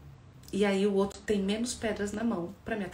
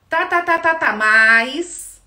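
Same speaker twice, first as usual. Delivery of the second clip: loud, higher impatient "tá, tá", dragged out slowly at the end; dismissive brush-off; 8 semitones higher, 15 dB louder, much slower than usual. Acted. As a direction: cut the other off, not listening. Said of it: "significa eu não te ouvi".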